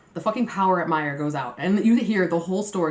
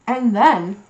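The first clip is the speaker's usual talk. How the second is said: loud; 4 semitones higher, 9 dB louder than usual. Real, not acted. Sudden loud exclamation.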